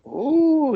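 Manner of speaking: very high, loud delighted coo; a gleeful coo over the bracers; delighted gnome coo. Acted.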